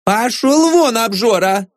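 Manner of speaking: high, irritated female voice; high-pitched shrewish dismissal; wife yelling back. A narrator's reading. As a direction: send him off in a high scolding voice. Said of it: "ответили из комнаты".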